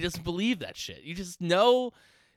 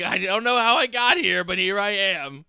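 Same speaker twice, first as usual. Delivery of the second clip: mock resigned Gunther; 5 semitones higher, 6 dB louder than usual. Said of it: "It's like".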